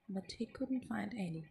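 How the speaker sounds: plain reading voice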